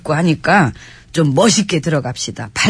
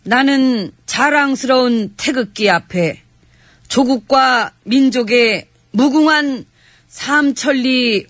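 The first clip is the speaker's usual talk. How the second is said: solemn recitation; 5 semitones higher, slower than usual. Acted. Solemn pledge and anthem.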